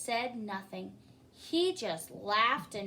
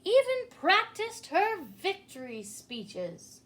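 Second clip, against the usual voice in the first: grand speech; 7 semitones higher, 7 dB louder than usual. A narrator's reading.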